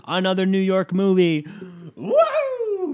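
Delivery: high, loud call topped with a whoop; intended hype version. Shown as a demonstration. Redoing the New York film line, hyped whoop. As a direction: announce it big, then shoot up to "woo". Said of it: "I wanted to go".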